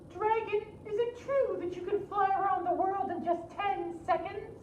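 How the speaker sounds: very high, sweet princess; high flattering princess. Acted. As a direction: flatter the dragon in a high girlish voice.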